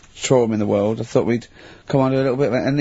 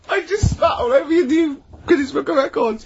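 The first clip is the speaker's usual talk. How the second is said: laughing while talking; 12 semitones higher than usual. Real, not acted. Laughing right after spitting tea.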